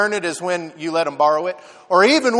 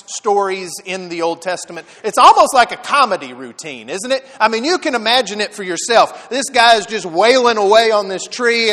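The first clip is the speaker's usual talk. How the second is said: ordinary delivery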